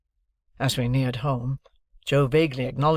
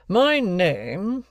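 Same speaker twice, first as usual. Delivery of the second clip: raised, deliberate lawyer's voice; stern lawyer; 7 semitones higher, 4 dB louder than usual. Acted. Jaggers introducing himself to Joe and Pip, stern lawyer. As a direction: announce yourself like a cool London lawyer.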